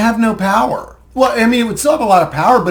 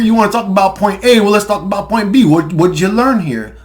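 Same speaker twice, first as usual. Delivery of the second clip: ordinary delivery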